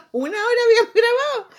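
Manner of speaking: high, loud, through laughter; laughing exclamation. Real, not acted. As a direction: cry it out while cracking up. Laughing over the lost recording.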